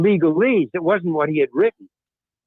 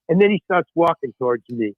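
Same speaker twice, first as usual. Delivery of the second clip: usual speaking voice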